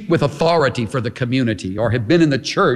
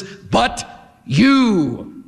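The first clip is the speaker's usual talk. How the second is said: BUT, a beat, then a long falling YOU; strong, emphatic; 4 semitones higher than usual. Shown as a demonstration. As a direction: drive YOU home with high, falling emphasis. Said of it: "very strong, emphatic".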